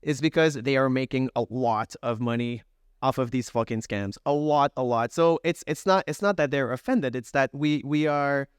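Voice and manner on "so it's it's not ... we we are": normal talking voice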